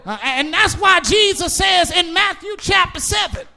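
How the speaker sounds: loud, rhythmic whooping preacher cadence; preaching at full force. Acted. Preacher to his congregation, preaching at full force. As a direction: drive each line home to the pews.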